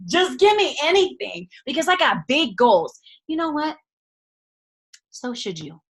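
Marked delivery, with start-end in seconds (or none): 0.1-1.5 higher, louder begging; desperate mock plea. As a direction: beg for anything at all. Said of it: "I'm like".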